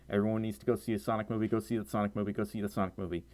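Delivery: quiet, low, rattled-off repeated plea; low rapid plug. Pretend. Streamer slyly urging viewers to the film, quiet and low. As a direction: slip it in fast and softly, like a subliminal message. Plugging the movie like subliminal message.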